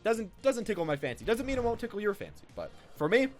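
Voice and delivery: usual speaking voice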